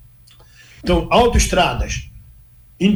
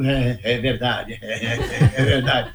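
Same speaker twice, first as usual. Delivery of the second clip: laughing along; 5 semitones lower, slower than usual. Real, not acted. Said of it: "eu fico só rindo".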